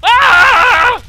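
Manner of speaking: sudden high piercing yelp; startled scream. Real, not acted. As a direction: jump out of your skin with a shriek. Startled by enemy.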